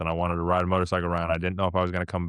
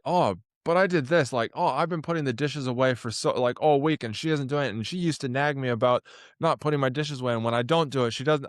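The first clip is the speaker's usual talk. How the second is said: higher-pitched mock grumble; petty complaining; 7 semitones higher than usual. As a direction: act out a self-justifying gripe about chores. Voices his own petty grievance.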